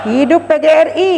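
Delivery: loud quick rally cry; rally speech. Real, not acted. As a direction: punch it out to the crowd. Closing rally chant to crowd.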